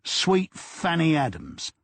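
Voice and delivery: slow, dry South London drawl; deadpan South London, slowly; a hint of South London; South London accent. A narrator's reading. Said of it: "in the less lilting sound of South London".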